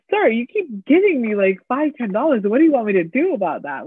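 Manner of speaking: exasperated, high-pitched protest; retold exasperation. Gas station clerk retelling argument with demanding customer, exasperated. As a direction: plead your case, pitch jumping up.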